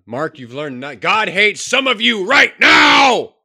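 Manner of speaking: high, loud, slow shouting sermon; mock preacher shout. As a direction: yell it like a fiery preacher. Mock preacher screaming at Mark.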